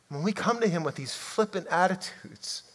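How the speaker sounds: normal talking voice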